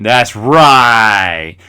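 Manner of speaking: smug teasing approval; mocking praise of a friend's lisp. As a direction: tease her like a little devil. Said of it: "mocking her speech impediment".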